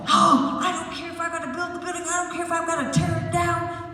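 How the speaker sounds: high, fast ranting boss; mock angry boss. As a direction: rant like a boss who won't back down. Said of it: "I was like".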